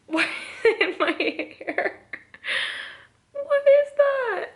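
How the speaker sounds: startled high squeak, then a laugh; surprised. Real, not acted. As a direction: caught off guard by her hair. Finds something in her hair.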